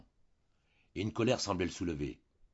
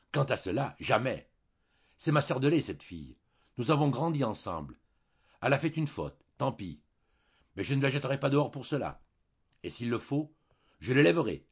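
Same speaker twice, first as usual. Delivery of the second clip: higher and louder revolt; indignant; 6 semitones higher, 6 dB louder than usual. A narrator's reading. Wife refusing to throw out her foster sister, indignant. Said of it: "indignée, se révolta".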